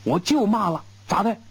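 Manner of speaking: high, stiff-necked challenge; defiant retort. A narrator's reading. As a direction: dare him, chin up. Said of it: "梗着脖子说".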